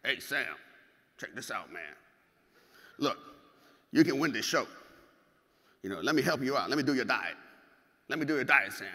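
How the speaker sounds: lightly imitated Mississippi bodybuilder, a bit low; a hint of a Mississippi guy's voice; Patrick Lynn. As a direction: do a light take on a Mississippi coach pitching help. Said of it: "His voice goes exactly like this".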